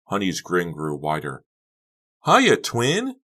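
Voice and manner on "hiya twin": very high, loud gleeful greeting. A narrator's reading. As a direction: squeal hello with glee. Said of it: "she exclaimed gleefully".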